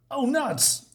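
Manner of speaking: high-pitched comic dismay; acted dismay. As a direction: play a doomed crook's "uh-oh". Gangster about to be shot.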